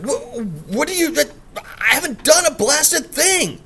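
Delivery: high, slow, spluttering outraged voice; sputtering gentleman. Acted. Accused gentleman denying the murder, high sputtering outrage.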